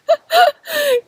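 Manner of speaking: high, loud burst of laughter; amused, high-pitched giggle; real laugh. Real, not acted. Amused by light beam on video.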